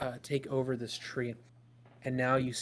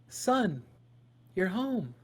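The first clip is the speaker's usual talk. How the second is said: higher, louder motherly greeting; delighted mother; 7 semitones higher, 4 dB louder, much slower than usual. Acted. Mother looks up from knitting as her son walks in, delighted. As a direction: pitch it up as a welcoming mother.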